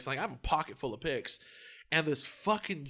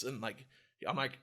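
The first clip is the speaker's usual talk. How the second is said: normal talking voice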